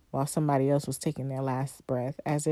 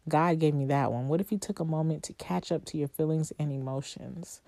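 ordinary talk, nothing marked

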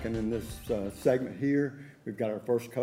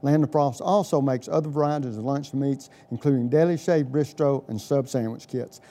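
upbeat, louder radio ad read; chipper 1950s ad; 3 semitones higher, 8 dB louder than usual. Acted. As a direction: sell it like a retro radio spot. Host reads sponsor ad.

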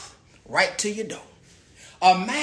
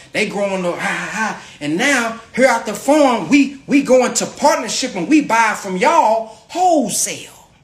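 ordinary talk

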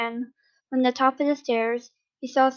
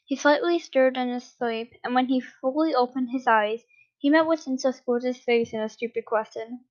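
usual speaking voice

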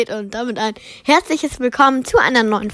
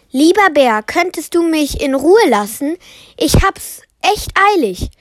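höher, bittendes kleines Mädchen; Mädchenstimme; 7 semitones higher than usual. Acted. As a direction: bitte den Bären höflich, aber eilig. Mädchen bittet den Bären. (Blaukleidchen bittet den Bären, höhere Mädchenstimme)

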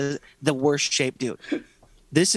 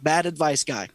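ordinary talk, nothing marked

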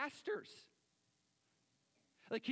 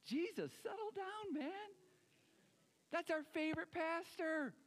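much higher, whining plea; mock whiny protest; 10 semitones higher than usual. Churchgoer pleading with Jesus to settle down, high whine. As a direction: beg him to calm down, comically high.

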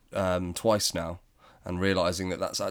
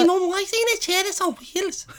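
put-on much higher, a small child asking mum; squeaky little kid's question; curious toddler; 4 dB louder than usual. Toddler asks mum about wheelchair.

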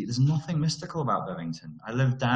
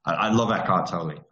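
usual speaking voice

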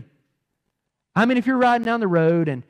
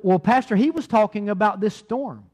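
ordinary talk, nothing marked

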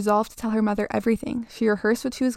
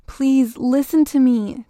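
put-on raised, urgent child's plea; pleading; 3 semitones higher, 6 dB louder, slower than usual. A narrator's reading. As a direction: beg to be heard, higher and louder. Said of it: "Jamie pleaded".

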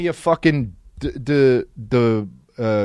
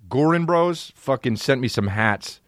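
usual speaking voice

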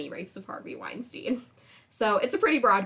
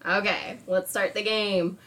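normal talking voice

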